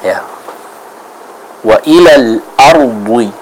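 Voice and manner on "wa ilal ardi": slow wa ilal ardi with heavy, emphatic D; measured recitation, full dhad in ardi; thick dhad. Shown as a demonstration. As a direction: draw out ardi, the D thickened towards "ardhwi". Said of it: "harus dibaca dengan tebal".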